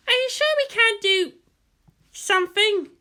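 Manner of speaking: put-on high, pleading girl voice; bored pilot begging for action; character voice. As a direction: whine the question, high.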